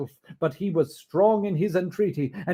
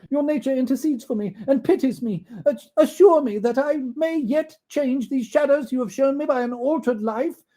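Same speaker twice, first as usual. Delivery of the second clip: put-on high, pleading old miser; Scrooge pleading; 9 semitones higher, 5 dB louder than usual. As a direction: beg the spirit for mercy, voice raised.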